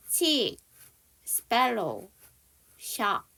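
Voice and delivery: "치, 스펠로우, 샤" said one by one with pauses; practice pronunciation model. Shown as a demonstration. Teacher leads learners in practice together, pronunciation model. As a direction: model "치, 스펠로우, 샤" separately for repeating. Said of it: "저랑 같이 한번 연습해봐요".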